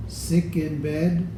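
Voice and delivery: plain reading voice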